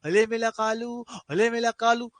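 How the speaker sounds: imitated sing-song baby talk; family cooing at a baby. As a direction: coo teasingly like doting relatives to a newborn. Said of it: "अपने घर वाले बोलते हैं".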